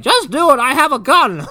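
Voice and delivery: high, loud granny threat; old lady threat. Retold or imitated. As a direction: threaten him like a pushy old woman. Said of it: "She's like".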